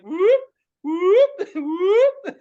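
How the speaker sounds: loud, high-pitched whoop; high whooping noise. Retold or imitated. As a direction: imitate a swooping sound effect, high and loud. Imitates quick readjusting of records.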